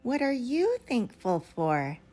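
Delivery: usual speaking voice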